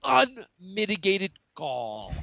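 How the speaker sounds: slow, drawn-out indignation; mock outrage. Pundit seizing on a co-host's phrase, theatrically outraged. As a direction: stretch each word out in theatrical offence.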